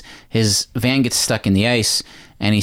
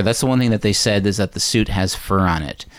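ordinary talk

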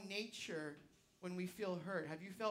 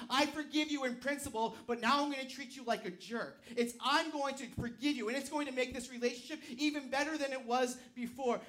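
rising, louder preaching toward the point; building sermon push; 5 semitones higher, 8 dB louder than usual. Acted. Pastor calling the church to complete forgiveness, building preaching. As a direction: lift the congregation with conviction.

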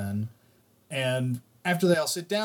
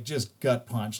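ordinary talk, nothing marked

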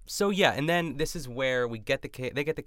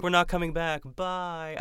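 higher, lightly mocking farewell; imitated departing hostess; 8 semitones higher than usual. Ina leaving the camera crew, mock cheery farewell. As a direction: lightly mimic a hostess calling goodbye. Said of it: "She's like".

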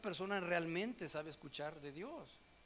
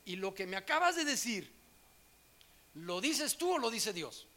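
challenging question; 6 semitones higher, 10 dB louder than usual. Retold or imitated. Young man challenges the counselor.

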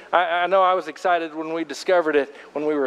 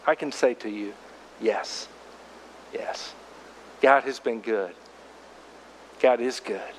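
tender, softer reassurance; 6 semitones lower, 6 dB quieter than usual. Retold or imitated. Tender reply to daughter.

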